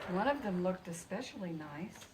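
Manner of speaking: ordinary delivery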